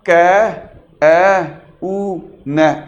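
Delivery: "कै, ए, ऊ, न" chopped into separate sounds with pauses; deliberately wrong split reading; broken into pieces. Shown as a demonstration. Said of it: "ऐसे पढ़ रहे हो आप?".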